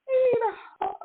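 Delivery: high little mock-crying voice; tearful pleading. Retelling how she asked her best friend for comfort, tearful plea. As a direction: plead in a squeaky, just-cried voice.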